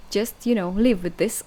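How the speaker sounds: ordinary delivery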